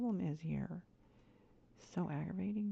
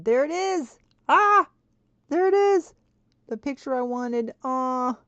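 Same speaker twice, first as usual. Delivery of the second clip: much higher, much louder joyful shriek; real delighted squeal; overjoyed squeal; 8 semitones higher, 11 dB louder than usual. Real, not acted. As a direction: shriek with relief at the find. Finds the lost picture.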